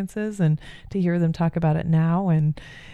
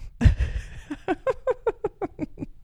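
airy giggle; 3 dB quieter than usual. Real, not acted. Laughing at own joke.

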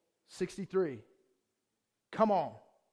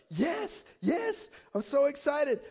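high, eager, drawn-out yeses; excited child; 7 semitones higher, 4 dB louder, much slower than usual. Retold or imitated. Preacher imitating six-year-old eager for baptism, excited. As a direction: bubble over like a thrilled little kid. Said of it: "Soraya, are you excited".

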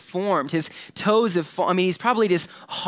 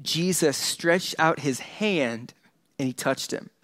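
slow reread; 3 dB quieter, slower than usual. Shown as a demonstration. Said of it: "I wanna read this again".

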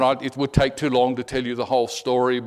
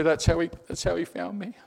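higher, lightly choked-up imitation; a touch of mock tears, pitched up; tearful imitation; 4 semitones higher than usual. Convert telling how he was found, mildly tearful imitation. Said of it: "He'd cry".

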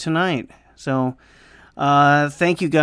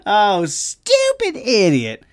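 self-scolding; 7 semitones higher, much slower than usual. Real, not acted. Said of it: "did I not mute again?".

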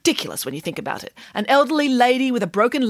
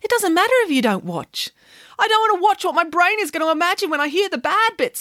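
put-on child voices; 9 semitones higher than usual.

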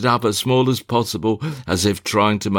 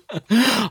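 high short laugh; 13 semitones higher than usual. Acted. [name] laughs mid monologue.

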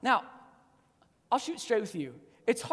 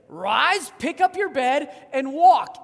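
ringing command, louder and louder to the end; commanding call; 8 dB louder than usual. Retold or imitated. Jesus commands the paralytic.

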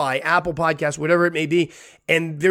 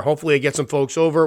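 normal talking voice

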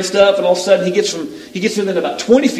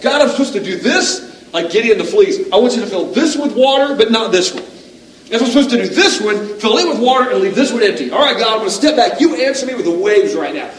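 higher, louder bargaining with God; demanding prayer; 6 semitones higher, 3 dB louder than usual. Retold or imitated. A desperate preacher demanding a sign on the beach. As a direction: lay down terms and insist.

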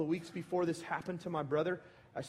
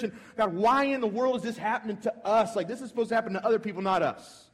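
rising anguished protest; 7 semitones higher, 5 dB louder than usual. Retold or imitated. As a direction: pitch climbs high and loud, pleading with God. Retold anguished protest to God.

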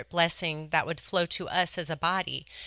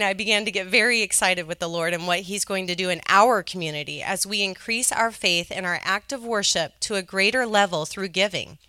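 usual speaking voice

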